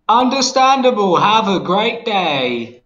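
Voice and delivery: slow, high robotic read; text to speech voice. Pretend. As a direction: stiff machine reading a message. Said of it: "I'll read it in a text to speech voice".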